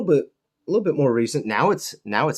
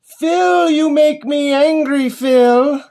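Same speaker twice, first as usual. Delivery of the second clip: much higher, much louder hammed-up rant; mock angry yell; 14 semitones higher, 8 dB louder, much slower than usual. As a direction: yell at Phil in exaggerated fury. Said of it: "that made me so angry".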